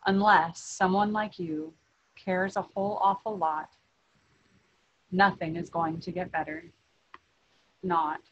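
plain reading voice

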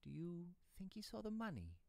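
a touch of worry, pitched high; anxious. Acted. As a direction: ask father uneasily, voice lifted. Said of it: "I asked anxiously".

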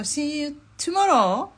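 high, loud, long-drawn farewell rising at the end; confident drawn-out send-off, pitched high; cheery sign-off. Real, not acted. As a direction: sing out the goodbye slowly, lifting the last word. Cheery sign-off, then hedged retake.

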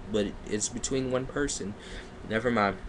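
normal talking voice

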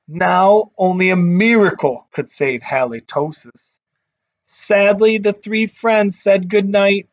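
put-on lively read-aloud voice for kids; storybook narration. A narrator's reading. Teacher reading a picture book to her class, lively storytelling.

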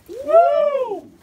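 high, loud "woo"; victory whoop. Shown as a demonstration. Leader showing the congregation how to respond, high victory whoop. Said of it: "we say".

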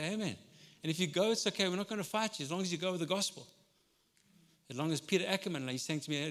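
ordinary talk

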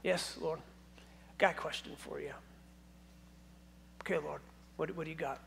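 hushed, gentle dialogue between God and Samuel; soft God voice. Retold or imitated. God softly calling Samuel to talk, hushed and gentle. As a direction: speak it softly, like a quiet check-in. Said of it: "I'm gonna ad-lib just a little bit".

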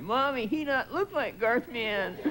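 high, drawn-out mock toddler wail; crying little kid. Little kid complains to mommy, mock crying. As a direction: whine it like a tearful small child, stretched out. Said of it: "They start crying".

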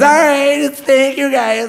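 high sing-out goodbye, imitated; sweet older woman. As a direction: take cheery leave as an older lady fan. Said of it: "So she goes".

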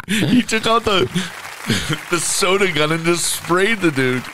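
high, laughing through words; laughing while talking. Real, not acted. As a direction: talk through a giggle fit.

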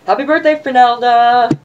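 high, loud, drawn-out cheer; a big sing-song birthday shout to a friend. Real, not acted. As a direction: belt it out like a party greeting.

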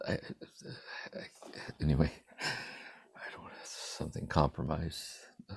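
real hushed whisper, off to the side; whispered aside. Real, not acted. Speaking to the listeners while someone is nearby, a whispered aside. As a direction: drop to a whisper so the family won't hear.

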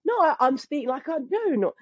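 high, protesting fake English accent; botched English accent. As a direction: insist in a badly faked English accent. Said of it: "my tongue had forgotten what to do".

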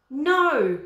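sharp loud no; irritated no. A narrator's reading. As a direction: snap the no. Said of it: "becoming irritated".

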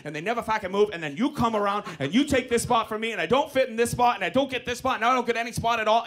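fast run-on complaint; aggrieved rant. Retold or imitated. Driver explaining how the spot was taken, rapid aggrieved rant. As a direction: pour out the grievance without stopping. Driver's acted parking grievance.

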